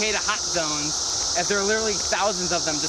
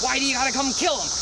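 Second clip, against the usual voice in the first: normal talking voice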